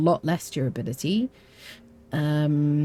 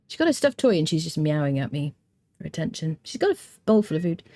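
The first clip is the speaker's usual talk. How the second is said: ordinary talk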